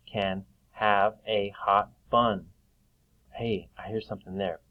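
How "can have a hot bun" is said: slow reading aloud. Shown as a demonstration. Teacher rereads sentence slowly.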